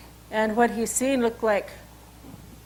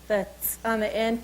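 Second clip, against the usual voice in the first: usual speaking voice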